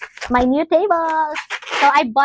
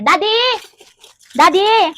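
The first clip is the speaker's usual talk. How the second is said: high, loud call for Daddy; 8 semitones higher, 8 dB louder, slower than usual. Real, not acted. Vlogger calls Daddy for a screwdriver, calling out. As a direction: shout for Daddy across the house.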